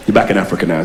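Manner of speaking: ordinary delivery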